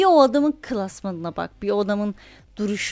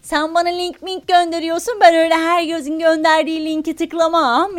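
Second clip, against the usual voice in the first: put-on Romani accent; 5 semitones higher, 4 dB louder than usual. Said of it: "roman şivesiyle falan konuşuyor".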